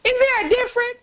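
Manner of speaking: high, loud disbelief; surprised. Real, not acted. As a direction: ask it in shock. Surprised question.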